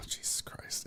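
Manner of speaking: sighed, barely audible whisper; very soft, breath-only exasperation; appalled whisper. Retold or imitated. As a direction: imitate a dismayed onlooker whispering under the breath. Todd's appalled sigh, acted out.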